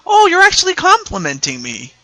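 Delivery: high-pitched imagined reply; acted high, gullible voice; naively flattered. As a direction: play someone innocently taking it as praise. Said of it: "they might mistake it as".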